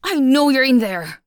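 loud, high, jeering yells at the door; taunting shouts to get him to open up; shouting through a door. Acted. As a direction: bang on the door and bellow at him. Bully banging on the door.

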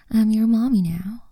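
usual speaking voice

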